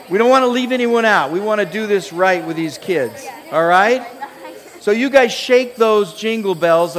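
loud, high excited calling out; hoarse marathon cheering. Real, not acted. Pastor calling kids up excitedly.

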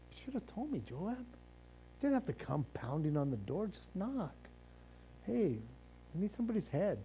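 very soft, understated acted dialogue; hushed acted aside. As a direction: play it down almost under your breath. Acting woman's mild talk with Joab.

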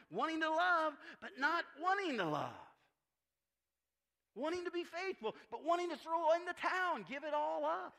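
loud, high-pitched preacher's delivery; impassioned preaching. Real, not acted. Preacher to congregation on wanting to be faithful, impassioned preaching. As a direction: drive each phrase out forcefully, high and loud.

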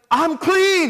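high, loud jubilant yell; loud cry, pitched high. Acted. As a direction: shout the good news out. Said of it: "to yelling".

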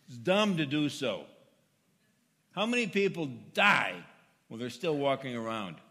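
loud, high, rising preacher's resolve; rally the congregation with conviction; fervent preaching. Acted.

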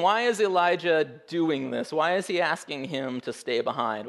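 usual speaking voice

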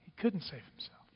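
barely voiced, letting the taunt sink in; hushed aside. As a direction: drop to a near whisper.

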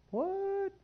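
squeaky startled high "What?"; shocked what. Pretend. Old churchgoer startled by a young teacher, shocked squeak. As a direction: yelp it in sudden disbelief. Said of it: "some of the old people are like".

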